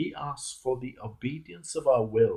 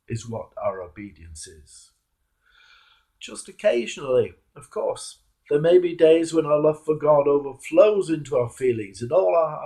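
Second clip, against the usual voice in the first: normal talking voice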